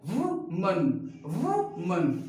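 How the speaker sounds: वुमन as "WU-mun", two beats; correct singular pronunciation. Shown as a demonstration. Teacher giving the correct form, वुमन as "WU-mun". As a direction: say वुमन with a weak "mun" ending, falling. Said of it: "इससे बोला जाता है".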